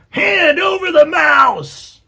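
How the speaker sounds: high, slow, full-volume yell; rat shouting. A narrator's reading. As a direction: scream the demand, stretching it out. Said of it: "shouted another".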